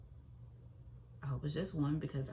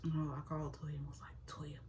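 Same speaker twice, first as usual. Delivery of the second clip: hushed retelling; 10 dB quieter than usual. Retold or imitated. Secretly telling Toya the news.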